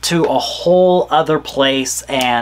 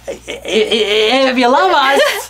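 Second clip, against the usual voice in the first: excited squeal; 10 semitones higher, slower than usual. Real, not acted. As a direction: squeal it out, almost crying with glee. Stammering squeal in outro.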